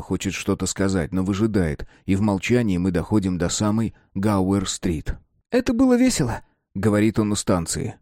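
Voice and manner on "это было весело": put-on high boyish voice; Mark's voice. A narrator's reading. Mark telling Simon it was fun, high boyish voice. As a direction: speak as the young friend Mark.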